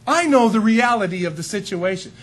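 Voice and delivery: high, insistent, self-assured voice; smug know-it-all. Pretend. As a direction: play the guy who insists he alone is right. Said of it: "This guy says".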